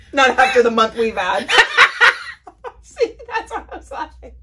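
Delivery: high, loud laughing speech. Real, not acted. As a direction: crack up while talking. Laughing hard.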